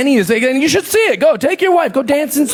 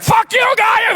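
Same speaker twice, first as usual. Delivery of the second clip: angry yell; 13 semitones higher, slower than usual. Pretend. Angry local yelling at a tourist who mislabeled him. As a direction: bark it back like an offended local. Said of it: "They'll be like".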